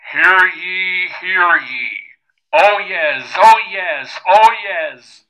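booming town crier through a megaphone. Pretend. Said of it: "the all important".

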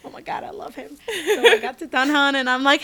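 usual speaking voice

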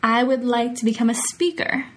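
ordinary talk

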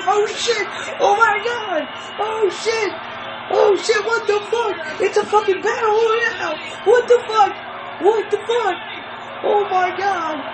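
very high, loud disbelieving shrieks; excited screaming. Real, not acted. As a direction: scream in giddy disbelief, laughing. Losing it at the battle royale.

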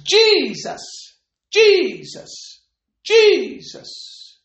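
high, loud drawn-out shout, falling away; shouted cry. Retold or imitated. Preacher reenacting a drunken man's desperate shout to Jesus. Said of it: "He shouted out three times".

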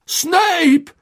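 high, loud desperate cry; desperate shout. A narrator's reading. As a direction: shout the name out frantically. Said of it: "rief er".

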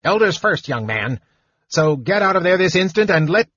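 high scolding gnome voice. A narrator's reading. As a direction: scold him like a fussy elder.

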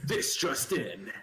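slow, low news anchor read; newscaster voice. Acted. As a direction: announce it like breaking news. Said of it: "Exactly, my newscaster voice".

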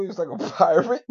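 loud laugh breaking through the words; cracking up mid-sentence. Real, not acted. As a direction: laugh while you say it. Laughing at partner.

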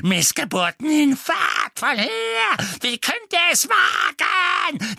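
shrieking, high, slow hateful crone; evil queen. A narrator's reading. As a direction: scream curses like a mad old witch. Screaming portrait of old witch.

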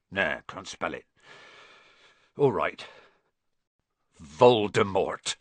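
brace yourself, then push the name out; Hagrid. A narrator's reading. Hagrid forces out the dreaded name.

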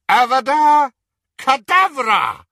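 high, full-force incantation; shouted curse. Acted. Killer casting the killing curse at a child, shouted. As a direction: hurl the spell out loud.

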